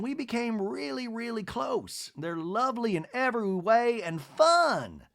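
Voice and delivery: high-pitched society matron impression; Miss Patricia. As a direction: imitate a lady gossiping in a high, lilting voice. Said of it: "And she said".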